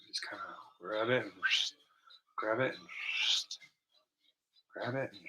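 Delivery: hushed breathy whisper. Acted. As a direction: whisper it like a secret. Whispering while painting.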